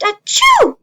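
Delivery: big high loud acted achoo; cartoon sneeze; huge sneeze. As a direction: sneeze it out for the kids. Acting out the sneeze.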